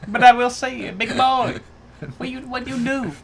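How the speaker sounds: put-on Vietnamese accent. Co-host doing a punishment for the show, Vietnamese accent. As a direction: speak with an exaggerated Vietnamese accent. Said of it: "I will do a Vietnamese accent".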